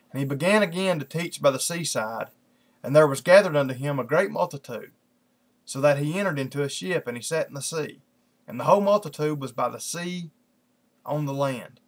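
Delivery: lifted, measured Bible-reading voice; scripture reading. Acted. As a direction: read the verses out to a congregation. Reads scripture aloud.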